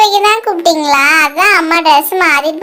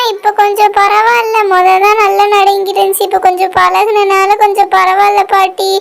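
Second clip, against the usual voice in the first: normal talking voice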